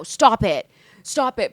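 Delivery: loud mock telling-off; mock scolding. Imagined critics telling her off for cockiness, mock scolding.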